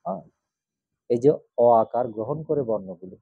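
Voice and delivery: ordinary delivery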